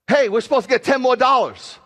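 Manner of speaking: high, loud mock shriek about money; mock panic. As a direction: squeal it like a panicked penny-counter. Mocking worried believer, shouting.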